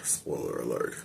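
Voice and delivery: whispered spoiler warning under the breath; soft unvoiced spoiler alert; hushed aside. Real, not acted. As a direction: drop to a near whisper for the warning. Spoiler warning before the recap.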